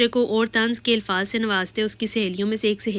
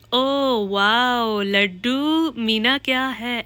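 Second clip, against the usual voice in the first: very slow, stretched exclamation; drawn-out reaction; 2 semitones higher, 4 dB louder, much slower than usual. A narrator's reading. Reacting to the nickname Laddu, long drawn-out exclamation. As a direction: stretch each word right out, slightly raised.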